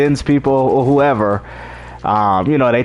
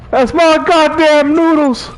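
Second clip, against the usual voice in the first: high, slow, louder whine; hammed-up high squeal; mock whiny wail; 12 semitones higher, 4 dB louder, slower than usual. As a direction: whine it out like a robbed kid. Mocking Marlon again.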